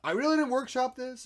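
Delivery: high, loud imitation of a flustered apology; apologizing puppeteer. Imitating first puppeteer excusing himself to class, high apologetic voice. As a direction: plead it high, mocking the nervous student. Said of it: "he always, like, apologizes".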